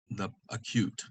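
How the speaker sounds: usual speaking voice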